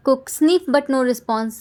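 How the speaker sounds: ordinary delivery, nothing marked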